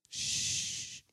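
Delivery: long, soft shush; drawn-out hush. Real, not acted. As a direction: hush the room with a drawn-out shh. Hushing the congregation.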